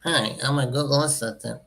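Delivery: imitating a nerdy virtual assistant; lightly mock a nerdy robot helper. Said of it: "What a nerdy voice".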